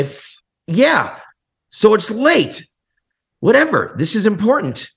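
high, loud, impatient insistence; retold insistent phone argument. Musician telling distributors the late change matters, loud and insistent. Retelling an urgent call to distributors.

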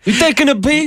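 high mocking De Niro taunt; hammed-up tough guy question; De Niro on the toilet. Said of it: "we'll try it again".

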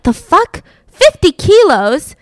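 high, loud, slow disbelief at the tiny number; incredulous scoff. Retold or imitated. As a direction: scoff at the insult of it. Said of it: "So he's like".

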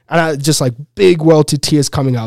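ordinary delivery

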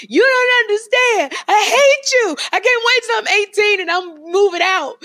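put-on high, loud dramatic teenage scream. Woman re-enacting screaming at her mother, high dramatic shout. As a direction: re-enact a tantrum at full pitch. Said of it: "I would scream".

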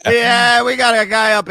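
gruff dispatcher calling a pickup; taxi dispatch radio. Acted. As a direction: bark it like a cab dispatcher. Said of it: "just like a taxi cab dispatcher".

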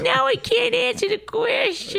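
very high, slow, drawn-out put-on voice; mock helplessness. Wife teasing husband over his question, mock helpless. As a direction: stretch it out in a high mocking wail.

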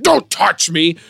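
put-on drunken protest. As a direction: push helpers away with your voice. Drunk man repels helpers.

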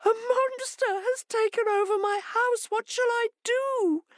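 very high, squeaky distress; frightened hare wail. Acted. Hare finds a monster in her house, wailing in fright. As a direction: keep it up high, helpless and wailing. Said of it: "she trembled in fright".

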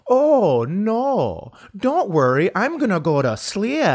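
fake-cheerful brush-off; mock-sweet sarcasm. Comic answering sarcastic lady passenger, loud mock-sweet sarcasm.

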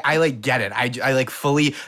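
ordinary talk

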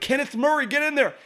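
high-pitched imitation of a sideline call; coach's urging. Coach sending his first round pick in, high-pitched urging. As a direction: urge the player in like an excited coach.

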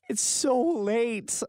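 high, slow, wavering mock-sob voice; imitating teary wife. Husband mimicking his crying wife on the couch, high slow mock sob. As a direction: stretch "so late" like a tearful whine.

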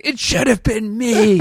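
high, loud anguished cry through laughter; anguished survivor. Pretend. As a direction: wail it, half laughing. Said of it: "the guy in the other track's like".

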